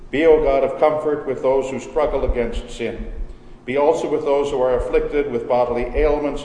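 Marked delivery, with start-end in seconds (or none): none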